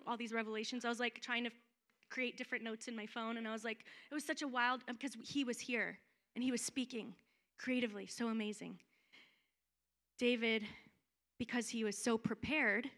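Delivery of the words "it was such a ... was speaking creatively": normal talking voice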